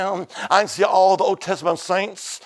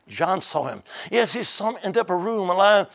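usual speaking voice

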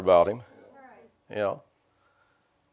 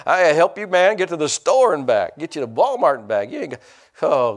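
ordinary delivery

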